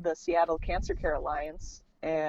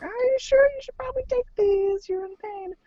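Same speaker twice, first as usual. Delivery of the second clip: very high mimicked coaxing voice; high-pitched mock concern; coaxing staff. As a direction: imitate a nurse pressing pills on a patient. Staff coaxing her to take pills.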